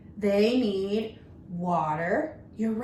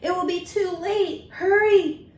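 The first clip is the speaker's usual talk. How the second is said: urgent hurry; 8 semitones higher, 5 dB louder than usual. Acted. Urgent moment in read-aloud story.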